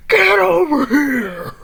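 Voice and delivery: loud, slow, commanding call; confident shout. Acted. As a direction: bellow it at the chicken. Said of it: "he walks up confidently saying".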